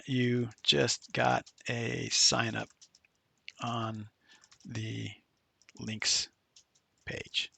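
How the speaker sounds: spoken slowly word by word as if typing; slow, trailing dictation. Shown as a demonstration. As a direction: drag each word out while you type it.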